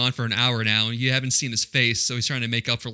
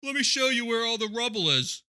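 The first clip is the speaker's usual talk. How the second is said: mock henchman; 10 semitones higher than usual. Voicing Simon's crew.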